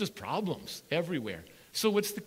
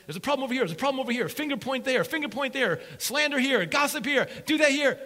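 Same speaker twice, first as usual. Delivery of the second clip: higher, whiny blaming voice; mock the gossips pointing fingers; finger-pointing complainers; 7 semitones higher, 6 dB louder, faster than usual. People complaining and blaming, mock whiny.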